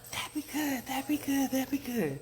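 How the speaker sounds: tiny, very high squeak; small squeaky frog echo; little frog peep. A narrator's reading. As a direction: peep it back in a wee voice. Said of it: "the little frogs peeped back".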